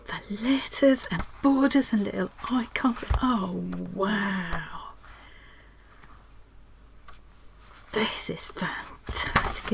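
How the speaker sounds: slow, hushed, unvoiced; awed whisper. Real, not acted. Whispering to viewers over the new book. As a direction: whisper it in wonder.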